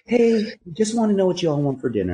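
high put-on voice for his wife asking about dinner; wife on the phone. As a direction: mimic the wife, pitched well up. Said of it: "And she goes".

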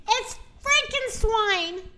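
high, drawn-out alarm without volume; a storyteller's restrained scream, pitched high; mock scream. A narrator's reading. Rooster seeing the glowing pig, mock screaming. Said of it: "screamed the rooster".

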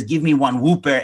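WHOOPER with "oo" and an open ending; imitating father's botched Whooper; mispronounced Whopper. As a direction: order a "WHOO-pair" like dad. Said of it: "So he says".

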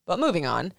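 ordinary talk, nothing marked

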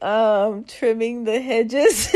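slow, laughing through the words; sheepish laughing confession. Retold or imitated. A mom telling her son she is doing yard work, laughing. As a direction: confess it while giggling.

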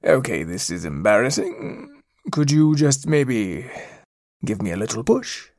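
awkward plea from a stuck cat; sheepish stuck villain cat. Acted. Stuck cat asks for help.

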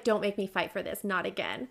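gentle quoted plea; soft plea. Retold or imitated. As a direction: plead quietly. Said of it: "she's like".

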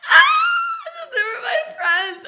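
high, loud laughing shriek; mock horrified shriek. Storyteller reliving finding her friends at the door, shrieking. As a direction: squeal it out, half laughing.

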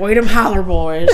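loud, slow mock Appalachian drawl; laughing, hammed-up Kentucky hill twang; holler accent. Imitating Kentucky relatives for a laughing co-host, holler accent. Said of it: "They got holler accents".

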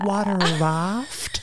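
put-on slow drawn-out Valley girl; Valley girl impression. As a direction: mimic her slow ditzy question. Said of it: "Can I do a quick impression?".

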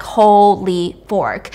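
very slow, drawn-out disbelief; lightly acted astonishment; stunned reaction. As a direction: stretch each word in mock shock. Acts Hannah's stunned reaction.

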